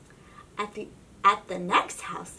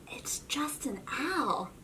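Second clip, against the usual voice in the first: slow relieved whisper; slow unvoiced whisper; 4 semitones higher, 6 dB quieter than usual. A narrator's reading. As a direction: breathe it out softly. Relief after owl scare.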